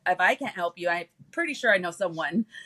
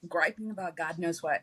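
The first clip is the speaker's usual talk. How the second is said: ordinary talk, nothing marked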